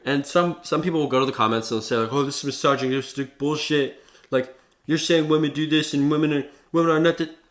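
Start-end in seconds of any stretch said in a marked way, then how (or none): 2.1-7.4 higher, louder whiny commenter voice; mock voice of an offended commenter. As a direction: parody an outraged critic. Mocking commenters calling it misogynistic.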